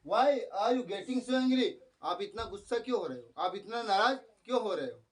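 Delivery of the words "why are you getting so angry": very slow, higher; slow English quote. Retold or imitated. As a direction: spell out the question slowly. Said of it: "ऐसे बोला".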